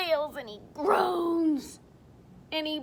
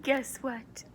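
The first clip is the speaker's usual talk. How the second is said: unvoiced secret whisper; 3 dB quieter than usual. Real, not acted. Whispered to listeners.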